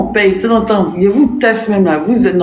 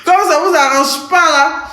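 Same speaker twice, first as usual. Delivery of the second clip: laughing; 9 semitones higher than usual. Real, not acted. Giggly mocking repeat.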